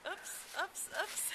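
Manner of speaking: soft whispered oops. Real, not acted. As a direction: breathe it under your voice. Child falls over.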